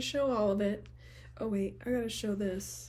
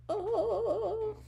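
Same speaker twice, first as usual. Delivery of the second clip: much higher cooing oh; delighted oh; 14 semitones higher than usual. Real, not acted. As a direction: coo over something lovely. Delight at the box.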